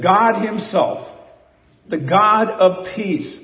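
slow, stress on GOD; Paul's emphatic words. Shown as a demonstration. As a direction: dwell on God himself slowly. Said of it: "Paul simply says".

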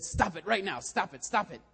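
loud, high mock-furious shouting; hammed-up angry outburst; exasperated, so mad. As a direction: yell it in acted exasperation. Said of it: "Matt was so exasperated".

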